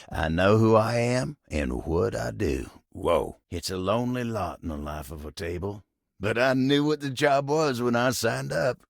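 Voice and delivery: ordinary delivery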